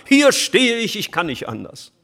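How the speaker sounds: Luther's defiant stand, triumphant. Retold or imitated. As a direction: declare it boldly at the Reichstag. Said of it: "sowas Triumphales wie damals am Reichstag".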